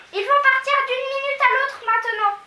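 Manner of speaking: high, loud hurry; urgent high girl's cry. A narrator's reading. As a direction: rush her friend along. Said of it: "s'écria-t-elle".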